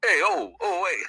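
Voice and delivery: loud, high Brooklyn accent burst; hammed-up Brooklyn tough-guy call. Shown as a demonstration. Asked for a snippet, does a Brooklyn accent. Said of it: "Can you give us a snippet of the Brook".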